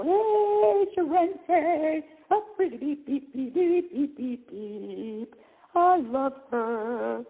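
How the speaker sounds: high, sing-song cooing at a beloved pet. Real, not acted. Host greets her pet Sharente mid-show, high cooing baby talk. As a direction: coo up high like greeting a puppy.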